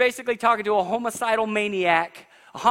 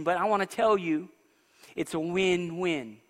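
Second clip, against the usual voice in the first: ordinary talk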